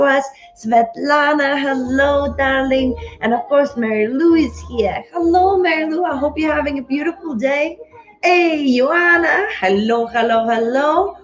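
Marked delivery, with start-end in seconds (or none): none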